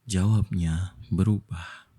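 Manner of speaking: ordinary delivery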